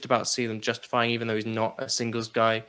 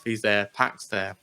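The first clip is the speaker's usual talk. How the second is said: ordinary talk, nothing marked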